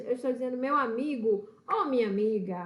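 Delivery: ordinary talk, nothing marked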